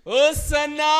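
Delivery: high, full-force preaching shout; shouted praise. Acted. Preacher crying hosanna to Jesus, high shout. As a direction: build to a huge hosanna cry.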